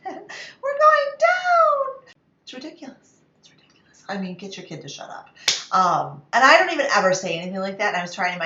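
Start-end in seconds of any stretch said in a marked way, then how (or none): 0.1-2.1 put-on high, loud, very slow child's wail; hammed-up child voice crying out; little boy's cry. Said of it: "the same little voice". 3.4-4.0 unvoiced, dropped to a whisper; whispered exasperated aside; whispered under breath. Real, not acted.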